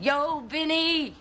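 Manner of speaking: high, drawn-out holler; stretched, high-pitched greeting; drunken hail. Acted. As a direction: call out to a buddy, slow and high. Drunk, hails Vinny as friend.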